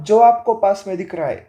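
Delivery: ordinary delivery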